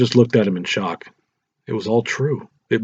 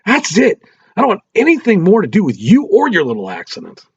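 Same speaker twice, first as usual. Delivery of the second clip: loud, higher, hateful yell; furious scream; 10 semitones higher, 5 dB louder than usual. A narrator's reading. Husband screaming at wife before storming out, loud and furious. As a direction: scream it with pure hatred. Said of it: "He screamed back at her with so much anger and hatred".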